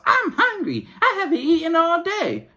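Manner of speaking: put-on very high, loud kid's whine; whiny child voice. Mom imitating her kids whining at McDonald's, very high and whiny. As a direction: beg like a little kid in McDonald's. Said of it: "they go to grandma and grandpa house".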